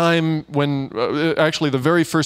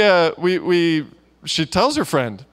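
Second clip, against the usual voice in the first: ordinary talk, nothing marked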